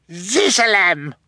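high, drawn-out little shriek. A narrator's reading. Baby sister protesting at villainous guardian, shrieking. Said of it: "Sunny shrieked".

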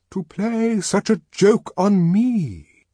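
high, loud, slow outrage; indignant. A narrator's reading. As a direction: declaim it in wounded indignation. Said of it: "The news was received with indignation".

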